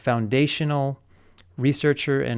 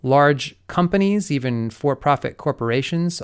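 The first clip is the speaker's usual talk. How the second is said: ordinary delivery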